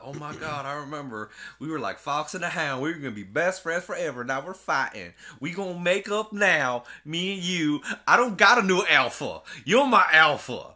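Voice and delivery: raptor remembering. Pretend. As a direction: build from wistful to a loud plea. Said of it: "He's like".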